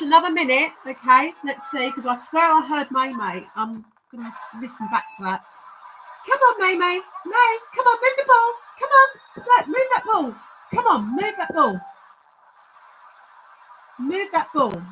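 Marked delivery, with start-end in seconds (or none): 6.3-14.8 very high, loud pleading dog owner; pleading pet talk. Acted. Begging the dog spirit to move the ball, very high loud pet voice. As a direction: beg her excitedly to move the ball.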